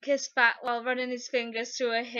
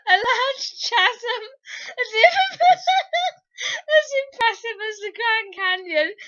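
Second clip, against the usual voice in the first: high, loud, giggling through the words; real laughter breaking the reading; laughing while reading; 7 semitones higher, 5 dB louder than usual. Real, not acted. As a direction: crack up mid-sentence. Reader laughing through lines.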